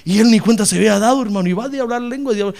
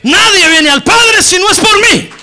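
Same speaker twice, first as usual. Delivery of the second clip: much higher and louder, slow shouted praise; preaching at full force; 11 semitones higher, 9 dB louder, slower than usual. Acted. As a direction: climax of the sermon, shout glory. Preaching climax, repeated glory.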